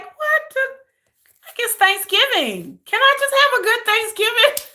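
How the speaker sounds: high, pleading disbelief; incredulous whine. Retold or imitated. To her viewers about the holiday, whiny disbelief. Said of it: "I'm like".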